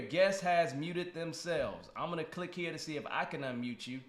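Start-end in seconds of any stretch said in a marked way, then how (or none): 0.0-1.9 very slow, deliberate readout; reading the screen slowly. Shown as a demonstration. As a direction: drag out each word of the message. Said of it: "'Cause it says right here".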